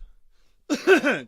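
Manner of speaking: loud, harsh throat-clearing cough; hard cough. Real, not acted. Clearing his throat before the demo, a loud harsh cough. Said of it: "clear throat".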